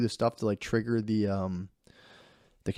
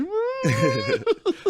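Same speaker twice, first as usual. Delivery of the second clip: high laugh. Real, not acted. Laughing at own near crying.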